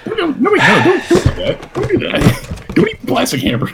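high, laughing pleas; laughing protest. Real, not acted. Co-host begging him not to eat plastic, laughing. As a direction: squeal no through laughter.

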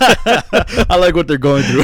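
slow, high, laughing through words. Real, not acted. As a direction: laugh while saying it. Mocking line while laughing.

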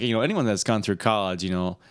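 ordinary talk, nothing marked

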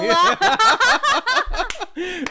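high loud burst of laughter; real belly laugh. Real, not acted.

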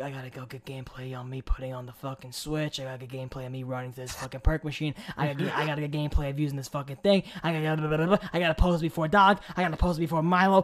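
fast, low anxious muttering; rushed anxious inner voice. Retold or imitated. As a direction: rattle off a nervous to-do list. Retells own anxious thoughts.

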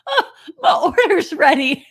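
bright "Oh" sung out with relief; cheerful quote. Retold or imitated. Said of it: "And then she finally said".

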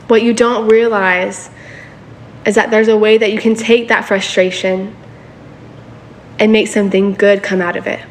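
normal talking voice

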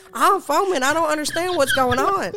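high, loud retold panic; freaking out. Niece tells uncle she is foaming, high acted panic. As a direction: wail it in mock alarm, pitched well up. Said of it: "I started freaking out".

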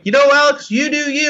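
high, light mock pushover voice; mock easygoing. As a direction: brush off a cheating opponent, lightly. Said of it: "if you're just kind of like".

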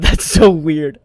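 slow and loud, laughing as you say it; laughing through words. Real, not acted. Teasing bug hunters, laughing through words. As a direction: laugh through that's so weird.

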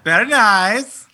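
high, slow Borat impression; Borat accent. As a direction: drawl "very nice" like Borat. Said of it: "Was that Borat?".

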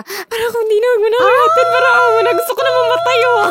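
high, loud, fast dramatic wail of embarrassment; nearly shrieking from embarrassment; mortified outburst. Real, not acted. (sobrang hiya, malakas at mataas na boses)

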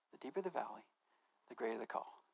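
a hushed, reflective echo of the words; soft repeat. Shown as a demonstration. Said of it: "repeats the saying softly".

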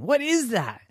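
put-on wide-eyed little boy asking; high child voice. Smelly sees candle first time.

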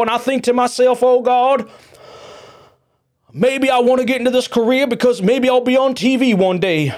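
high, loud, hammed-up preacher wail; mock melodramatic politician. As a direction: ham it up like a showboating lawmaker praying for the cameras. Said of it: "like these real dramatic performances".

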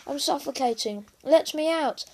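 high, desperate cry to escape; choking. A narrator's reading. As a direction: plead loudly to be let out. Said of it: "choked Bella".

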